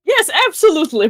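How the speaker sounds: high, emphatic yes; eager acceptance. Acted. Basha accepts an invitation to join, eagerly. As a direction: jump at the offer, pitched high.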